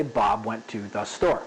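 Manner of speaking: low, evenly spaced robot voice; robotic. Shown as a demonstration. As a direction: say it like a machine, no spacing. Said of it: "that's a very robotic way of saying it".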